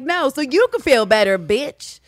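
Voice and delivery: high, loud comeback; mock angry retort. Wife snapping back at husband, mock anger. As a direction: throw it back with hammed-up sass.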